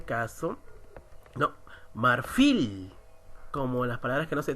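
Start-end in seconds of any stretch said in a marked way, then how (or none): 1.9-2.9 marfil said slowly, "fil" punched; stress on final syllable. Shown as a demonstration. Teacher testing the rule on a word, marfil stressed on "fil". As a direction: stretch and hit the "fil" in marfil. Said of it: "la mayor fuerza de voz en, está en la última".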